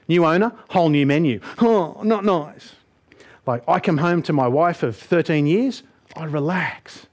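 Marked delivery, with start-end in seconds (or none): 1.6-2.7 disappointed. Pretend. Disappointed at new menu.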